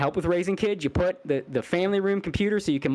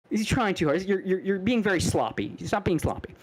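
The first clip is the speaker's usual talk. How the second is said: ordinary talk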